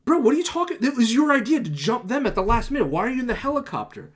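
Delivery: loud, high-pitched disbelief at a teammate; indignant outburst. Retold or imitated. Player calling out a teammate who ditched the plan, indignant. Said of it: "I'm just so pissed off".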